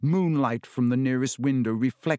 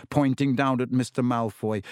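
ordinary delivery

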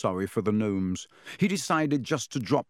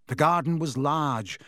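ordinary delivery, nothing marked

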